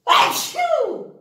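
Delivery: high hammed-up sneeze; fake sneeze. Teacher pretending to sneeze for the class, hammed-up. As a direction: play-act a big sneeze. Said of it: "Bless me".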